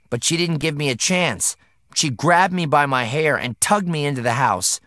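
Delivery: plain reading voice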